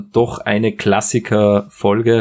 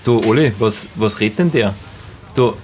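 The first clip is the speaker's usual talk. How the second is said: ordinary delivery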